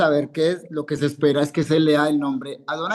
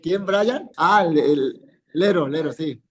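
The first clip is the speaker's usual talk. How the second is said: ordinary talk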